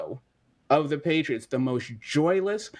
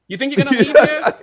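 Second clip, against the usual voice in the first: taunting Boston fan; 8 semitones higher, 8 dB louder, faster than usual. Pretend. Mock Boston fan taunting Kyrie.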